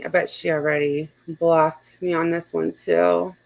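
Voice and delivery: ordinary talk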